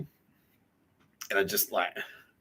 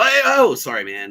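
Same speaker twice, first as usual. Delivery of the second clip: apologetic; 4 semitones higher, 9 dB louder than usual. Retold or imitated.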